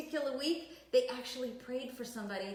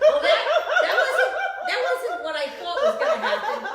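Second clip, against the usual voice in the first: high laughing protest; 14 semitones higher, 12 dB louder than usual. Real, not acted. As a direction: laugh it off, squealing. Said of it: "laughing disbelief after question".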